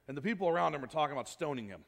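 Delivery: ordinary talk, nothing marked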